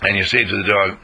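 normal talking voice

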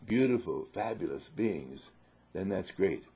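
usual speaking voice

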